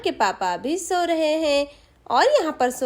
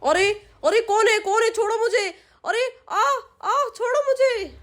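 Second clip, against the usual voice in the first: high, panicked cry of a man being grabbed; startled father; 6 semitones higher than usual. Acted. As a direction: struggle and protest in a raised voice. Voices startled father being attacked.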